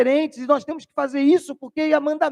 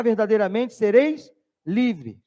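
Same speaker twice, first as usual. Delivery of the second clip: slow scripture quote; 2 semitones lower, slower than usual. Acted. Quoting scripture slowly.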